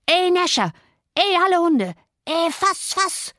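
high-pitched calls to the dogs; shrieky old man calling out in pursuit; old man's shout. A narrator's reading. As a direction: set the dogs on the thief. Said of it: "schrie der Alte, während er mich verfolgte".

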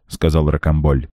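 usual speaking voice

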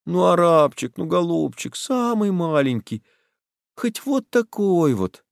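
high, coaxing begging voice. Acted. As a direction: coax him sweetly for a bite. Boy begging for chocolate.

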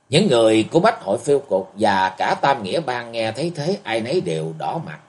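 ordinary delivery

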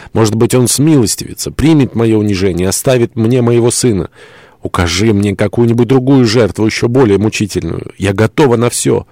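high, impassioned plea; desperate pleading. Acted. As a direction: beg to be given a sacrifice. Desperate plea to sacrifice herself.

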